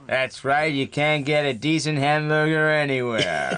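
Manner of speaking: drawn-out American movie-star impression; hammed-up cowboy star, slowing to the end; John Wayne. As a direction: stretch it into a slow mock drawl. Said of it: "John Wayne has kind of sort of said".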